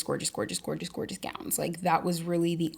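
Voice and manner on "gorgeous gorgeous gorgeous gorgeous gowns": breathy gush. Real, not acted. Gushing over gowns.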